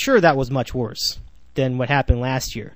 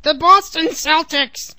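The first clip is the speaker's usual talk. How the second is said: much higher, slow squeal, a little louder; second try at mocking a host's squealy voice; squealy Andy Gresh voice; 11 semitones higher, 4 dB louder, slower than usual. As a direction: stretch out the team name in a squeaky whine. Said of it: "I can't do that voice".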